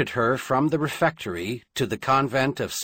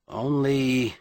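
usual speaking voice

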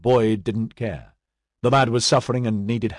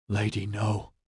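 hushed whisper of disbelief; 7 dB quieter than usual. A narrator's reading. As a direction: breathe it softly, almost under the breath. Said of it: "he whispered".

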